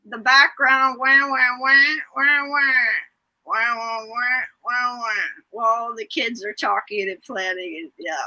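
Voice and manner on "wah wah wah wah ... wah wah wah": muted trombone wah wah; droning wah wah like a cartoon teacher; Charlie Brown adult wah-wah. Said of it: "like, in the Charlie Brown cartoons".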